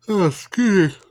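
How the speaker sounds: breaking into a yawn. Real, not acted. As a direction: yawn through the words. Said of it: "apologize for the yawning".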